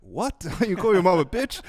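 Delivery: high, loud shocked question; outraged disbelief. Retold or imitated. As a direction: react appalled, laughing. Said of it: "And I was like".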